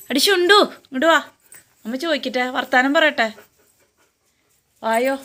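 high, sing-song pet talk. Real, not acted. Owner sweet-talking her dog to come, high and coaxing.

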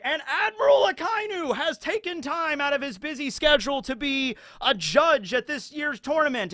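high, ringing tournament announcer. Retold or imitated. Announcer introducing the admiral judge, high and grand. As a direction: boom it out like a stadium host.

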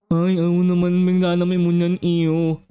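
lightly voiced low bee grumbling; a cranky giant bee, slurring its words; grumpy bee. Giant bee grumbling at the little kid, low voice.

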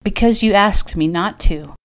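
usual speaking voice

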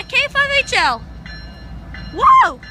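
ordinary delivery

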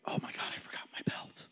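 a hushed, fast whisper, voice off; whispered realization. Retold or imitated. Lifter realizing mid-deadlift he forgot his belt, whispered. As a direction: whisper it like a sudden panicked thought.

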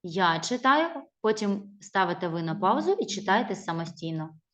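ordinary delivery

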